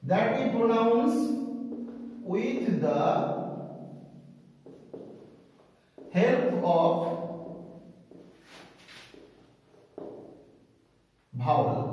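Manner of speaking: word by word, with long pauses; slow dictation. Shown as a demonstration. Teacher writing out the consonant definition, slow dictation. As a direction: dictate it very slowly, piece by piece.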